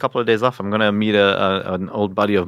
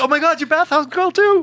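starstruck fan; 4 dB louder than usual. Pretend. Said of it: "You're like".

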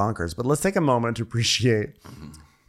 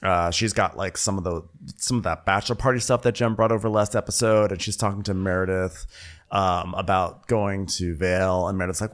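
usual speaking voice

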